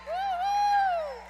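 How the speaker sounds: high loud whoop; cheer. Real, not acted. Cheering a student at the awards, loud high whoop.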